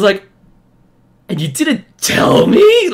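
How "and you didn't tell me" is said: higher, louder, slow indignant cry; betrayed squeal of outrage; outraged. Retold or imitated. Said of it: "I was so mad".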